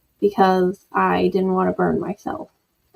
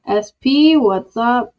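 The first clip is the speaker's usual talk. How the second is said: usual speaking voice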